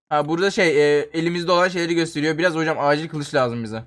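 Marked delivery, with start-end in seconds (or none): none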